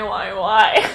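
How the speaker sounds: normal talking voice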